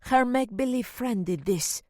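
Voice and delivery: scornful disbelief in the voice; frowning housekeeper. A narrator's reading. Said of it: "Miz Perez frowned".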